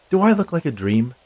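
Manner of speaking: high, loud challenge; skeleton spirit. Acted. Skeleton challenges doubting Harrison, high and loud.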